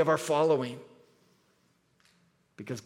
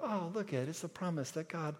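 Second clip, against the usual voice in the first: mock sentimental sing-song, much softer; sing-songy voice; 2 semitones higher, 10 dB quieter than usual. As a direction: coo over the rainbow like a sweet children's lesson. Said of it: "you kinda get that sing-songy voice".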